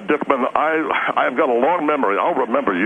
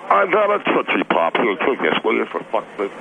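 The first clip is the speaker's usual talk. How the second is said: talking around a lollipop; mouth full; 6 semitones lower than usual. Real, not acted. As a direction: speak with candy in mouth. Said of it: "What are you eating?".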